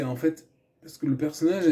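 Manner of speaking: ordinary talk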